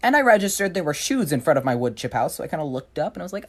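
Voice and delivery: ordinary delivery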